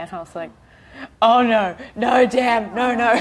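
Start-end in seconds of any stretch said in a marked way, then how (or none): 1.2-3.2 loud, higher-pitched retold protest; dismayed outburst. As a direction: cry out in emphatic dismay, reliving the reaction. Acting out reaction to the story.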